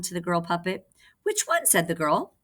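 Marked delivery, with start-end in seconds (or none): 1.2-1.6 put-on very high little girl's question. Girl puppet answering the king, very high. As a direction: squeak it like a small girl.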